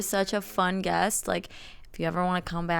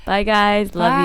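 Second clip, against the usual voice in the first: loud; 10 dB louder, slower than usual. Real, not acted. Shouted goodbyes at episode end.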